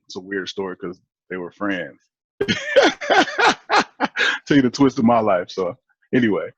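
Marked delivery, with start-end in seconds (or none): 2.4-4.4 high, loud burst of laughter; hearty laugh. Real, not acted. Guest amused by his own family story, laughing hard. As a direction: laugh out in a long, high run.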